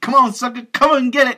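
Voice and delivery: high, loud taunting yell; taunting shout. Retold or imitated. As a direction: jeer at them over the hose. Hollywood taunting guards with hose.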